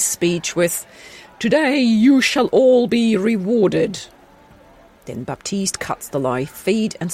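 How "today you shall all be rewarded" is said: regal proclamation. Acted. King ends speech.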